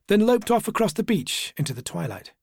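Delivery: usual speaking voice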